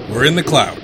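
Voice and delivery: ordinary talk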